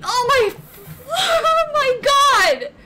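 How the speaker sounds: high, loud, drawn-out exclamation; ecstatic disbelief. Real, not acted. Streamer hitting another jackpot summon, ecstatic disbelief. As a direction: cry out in amazement, stretching the words.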